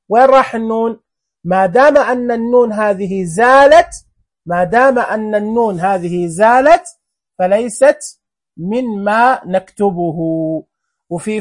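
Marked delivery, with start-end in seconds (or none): none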